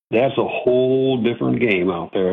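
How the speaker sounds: ordinary talk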